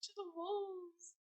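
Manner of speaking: very soft, high, hammed-up vampire accent; Dimitri. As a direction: imitate a spooky vampire accent, hushed and high. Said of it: "he really hams up the accent now".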